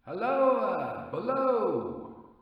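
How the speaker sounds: high, stretched hail down into the trench; calling down again. Acted. Narrator calls down again.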